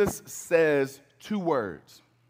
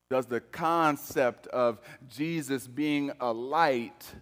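ordinary talk

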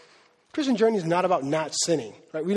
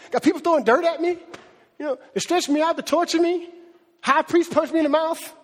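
self-pitying Paul; 7 semitones higher than usual. Pretend. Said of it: "Paul didn't go".

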